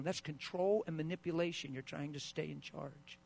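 ordinary delivery

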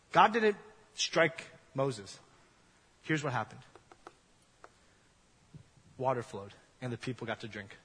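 ordinary talk

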